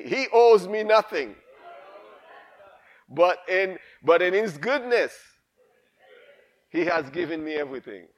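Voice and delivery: rising preacher's call for everyone to say it together. Shown as a demonstration. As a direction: belt it out so the whole room joins. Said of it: "Say that with me".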